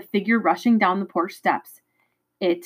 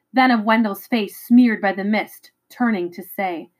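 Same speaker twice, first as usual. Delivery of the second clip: ordinary delivery, nothing marked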